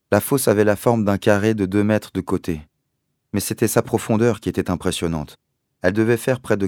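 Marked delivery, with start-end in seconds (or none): none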